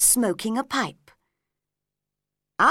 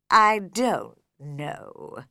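lower, very slow lazy creature; slow caterpillar voice; 3 semitones lower, much slower than usual. A narrator's reading. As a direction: drag each word out lazily. Caterpillar answers.

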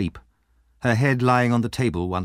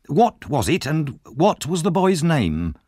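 slow, pressing, higher; greedy and urgent; 7 semitones higher, slower than usual. Acted. Missus Corney urging the dying woman, slow and greedy. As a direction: drag the answer out of her. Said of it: "said Missus Corney greedily".

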